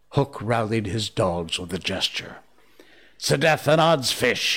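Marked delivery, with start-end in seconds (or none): 3.2-4.6 raised-pitch oath, mildly thunderous; thundered. A narrator's reading. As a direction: burst out with a captain's oath. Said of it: "he thundered".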